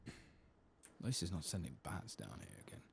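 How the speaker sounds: ordinary talk, nothing marked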